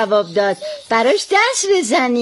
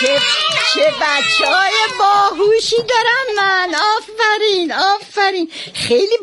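high, bright praise for the class; delighted praise; 11 semitones higher than usual. Real, not acted. Teacher praising her clever pupils, delighted.

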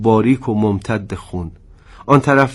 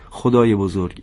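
usual speaking voice

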